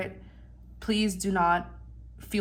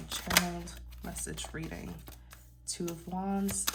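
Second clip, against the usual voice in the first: quiet murmur; 7 dB quieter than usual. Real, not acted. Murmured while pulling cards.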